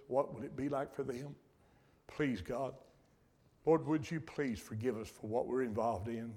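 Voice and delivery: soft, earnest prayer voice; closing prayer. Real, not acted. Pastor praying for Grayson and the church, softly. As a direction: pray quietly over the congregation.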